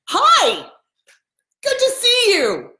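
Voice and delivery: high, loud, delighted greeting; big singsong welcome, drawn out; welcoming. Shown as a demonstration. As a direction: greet them with open enthusiasm. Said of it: "That's welcoming".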